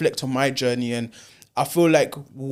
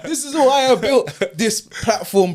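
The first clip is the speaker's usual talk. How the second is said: laughing through the words, higher and louder; 9 semitones higher, 5 dB louder than usual. Real, not acted. Host laughing at the guest's Malcolm X joke, talking through real laughter. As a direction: still laughing as he starts to speak.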